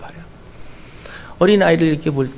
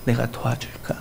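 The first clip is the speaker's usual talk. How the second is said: gentle offer; 6 semitones lower, 8 dB quieter than usual. Acted. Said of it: "이렇게 여러분에게 말씀할 거예요".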